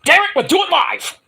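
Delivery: high-pitched mock outburst; mock exasperated outburst. Host riffing on going to break, exasperated mock outburst. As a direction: parody a presenter losing it on air.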